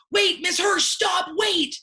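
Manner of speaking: put-on high, loud child's shout; kid calling out. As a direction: call out like an urgent kid. Students calling out to teacher.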